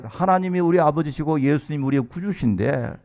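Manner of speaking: usual speaking voice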